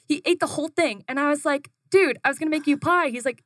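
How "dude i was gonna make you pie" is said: higher and louder, playfully put-out; mock indignant complaint. To Joey who ate the crust, a playful indignant whine. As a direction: whine that the pie is ruined.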